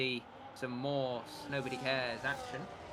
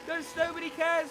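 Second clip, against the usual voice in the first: high excited race call; motorsport commentator; 14 semitones higher, 4 dB louder than usual. Real, not acted. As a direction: shout the overtake. Live race call, overtake.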